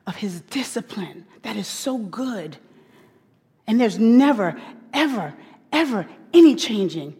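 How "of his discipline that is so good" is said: breathless whisper of wonder; whispered awe. Real, not acted. Preacher whispering God's goodness to the ladies. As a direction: let it drop to a whisper, awed.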